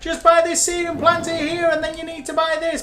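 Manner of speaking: high, loud hyped-up streamer shout; mock the money-promising hype streamers; shouty hype streamer. Mocking other streamers who shout about making millions. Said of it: "one of those who just shouts a lot".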